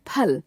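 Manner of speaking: फल begun with an aspirated p; फल as "p-hal", never f; aspirated Hindi ph. Shown as a demonstration. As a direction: say the फ in फल as a puffed p, no nukta. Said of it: "इनके उच्चारण में भिन्नता होती है".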